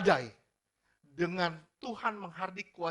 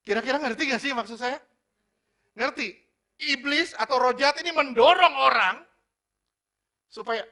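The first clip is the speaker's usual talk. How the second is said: higher, louder challenge to the hearers; fiery preaching; 9 semitones higher, 14 dB louder than usual. Acted. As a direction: demand that they understand. Preaching at full force.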